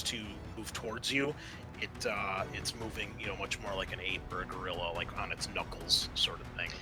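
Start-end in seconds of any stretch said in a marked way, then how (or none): none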